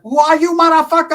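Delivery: high, loud threatening bark; tough gangster challenge. Retold or imitated. Shorty demanding to know who's there, loud bark. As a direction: confront him like a gang leader. Shorty confronting, acted quote.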